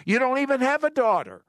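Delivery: you can't be serious, rising high; amazed pitch jump; incredulous. Real, not acted. Host reacts to wife's dream.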